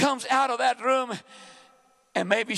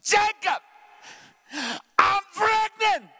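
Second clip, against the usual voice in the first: Leah's crying voice; 9 semitones higher, slower than usual. Retold or imitated. Leah telling Jacob she is pregnant, high crying wail. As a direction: wail the news up high and drawn out. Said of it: "That's the way she talked".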